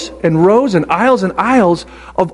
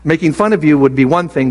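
ordinary delivery

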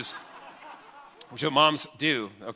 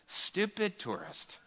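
imitating a scornful lifeguard, slow and softer; contemptuous attitude; 5 semitones higher, 9 dB quieter, much slower than usual. Lifeguard heading out to rescue a tourist, muttered contempt. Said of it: "you can see in his attitude".

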